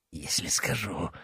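a choked, half-whispered plea; strangled. A narrator's reading. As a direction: squeeze the words out, throat pinned. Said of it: "сдавленно спросил Толян". (сдавленный полушёпот)

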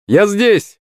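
high-pitched call across a distance. A narrator's reading. Акулыч coming out of the bushes, calling out. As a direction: pipe up to be noticed.

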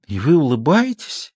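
slow, disbelieving stammer; can't believe he's smiling; incredulous babble. Acted. Klara asks her husband in slow incredulous wonder. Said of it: "недоверчиво пролепетала Клара".

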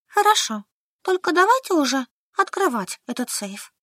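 сникшая, со всхлипом; пристыженно, шмыгая носом. A narrator's reading. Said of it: "шмыгая носом". (пристыженно)

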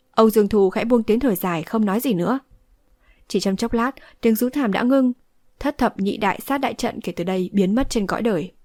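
plain reading voice

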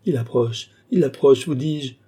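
frightened insistence, rising high and loud; terrified cry. A narrator's reading. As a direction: insist in mounting terror. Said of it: "s'écria Lorenza, terrifiée".

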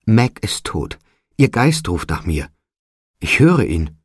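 crying out. A narrator's reading. Old man grieves for his dead daughter, restrained cry. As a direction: cry out in grief, without a full shout. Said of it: "schrie der alte Mann".

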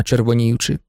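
usual speaking voice

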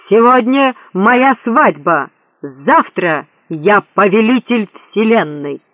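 loud, slow, triumphant proclamation; megalomaniac shouting. A narrator's reading. Prince losing his polish, megalomaniac cry. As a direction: crow it grandly to the whole world. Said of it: "вскричал Горбуль".

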